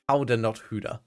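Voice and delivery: "Howdah" with an ow sound set against "hoodah" with oo; corrected pronunciation. Shown as a demonstration. Correcting own pronunciation after chat's help, clear contrast. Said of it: "Okay, it's howdah".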